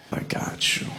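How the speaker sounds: soft, hushed reassurance; safe, reassuring voice. Retold or imitated. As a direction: imitate a spotter who makes someone feel safe, barely voiced. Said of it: "Everything about your voice is safe".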